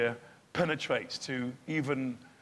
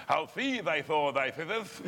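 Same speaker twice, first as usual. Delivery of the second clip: mock posh Shakespeare; 3 semitones higher, slower than usual. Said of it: "talking like".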